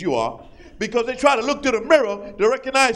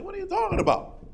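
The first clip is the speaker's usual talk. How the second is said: high baffled question; a confused, high what-are-you-talking-about; mock puzzlement; 11 semitones higher, 6 dB quieter than usual. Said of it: "You're like".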